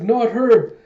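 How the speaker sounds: loud, high frantic yell; panicked yell. Retold or imitated. Terrified man yells at the crowd, loud and high. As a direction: shout it in a panic.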